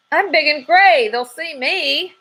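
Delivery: a scared squirrel panicking; high, slow, whining panic; panicky cry. A narrator's reading. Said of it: "cried the squirrel".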